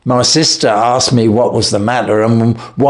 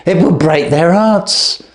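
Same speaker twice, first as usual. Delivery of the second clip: frantic begging, climbing much higher; desperate pleading; 7 semitones higher than usual. Acted. Thief begging the detective not to take him to court, frantic. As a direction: plead breathlessly, voice cracking upward.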